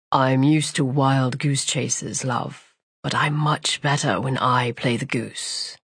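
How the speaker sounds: low, slow, smug threat; menacing villain. Acted.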